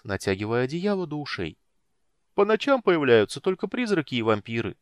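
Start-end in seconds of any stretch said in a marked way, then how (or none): 2.3-4.7 kender voice. A narrator's reading. Tass continues, sleepy.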